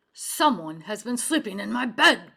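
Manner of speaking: loud bellow from Papa Bear. A narrator's reading. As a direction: bellow it at the whole room. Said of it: "Papa Bear bellowed".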